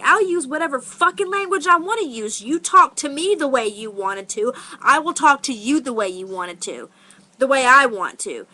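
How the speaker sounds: heated comeback, starting high and loud; defiant. Retold or imitated. Teller re-enacts answering the woman who objected to her language, defiant. Retold quarrel over bad language.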